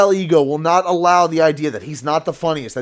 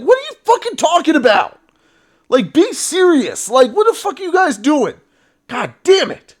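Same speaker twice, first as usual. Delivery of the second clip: wütender Ausbruch; 9 semitones higher than usual. Real, not acted. Furious rant at politicians.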